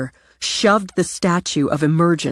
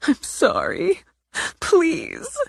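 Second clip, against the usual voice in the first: high, small, begging; tearful plea; 13 semitones higher, slower than usual. Acted. Natalie begging Cece, high and tearful. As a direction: plead in a thin high voice.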